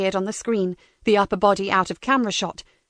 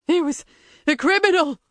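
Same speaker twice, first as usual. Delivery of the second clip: high, frantic prisoner confessing; desperate captive blurting it out; panicked prisoner; 7 semitones higher than usual. Acted. Prisoner protests.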